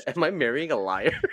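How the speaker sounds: loud, high accusation through laughter; playfully accuse, hammed-up and laughing; mock outrage. Partner doubting the wedding after hearing the clip, mock outraged.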